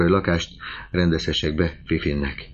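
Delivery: usual speaking voice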